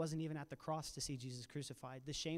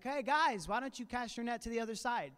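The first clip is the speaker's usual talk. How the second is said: raised, louder call across the water; calling out from the shore; 7 semitones higher, 5 dB louder than usual. Retold or imitated. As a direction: hail the fishermen from the shore. Jesus calls from the shore.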